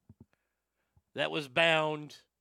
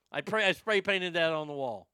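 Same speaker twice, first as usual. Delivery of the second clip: ordinary delivery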